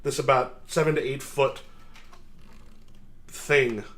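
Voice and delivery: ordinary talk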